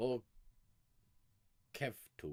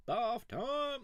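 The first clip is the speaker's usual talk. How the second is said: high, bright exclaim of bath time; gleeful squeal; excited cry; 13 semitones higher than usual. Real, not acted. Excited exclamation, pitch jump.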